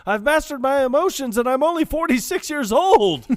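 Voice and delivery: high, loud, pompous boast; loud proud declaration. Retold or imitated. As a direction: boast it grandly. Quoting Holmes and Watson.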